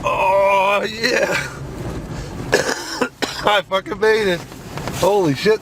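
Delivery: usual speaking voice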